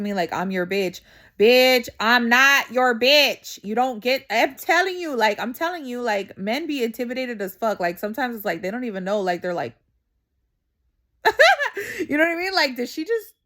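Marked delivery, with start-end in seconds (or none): 1.4-5.2 loud, slow put-down; drawn-out loud retort; mock telling-off. Telling off a man. 11.2-12.0 short high burst of laughter; real laugh, pitched high. Real, not acted. Laughing at her story.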